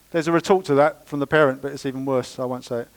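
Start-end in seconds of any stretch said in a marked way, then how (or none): none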